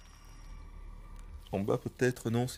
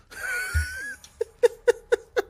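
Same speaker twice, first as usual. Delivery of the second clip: high shrieking laugh. Real, not acted. Bursts out laughing.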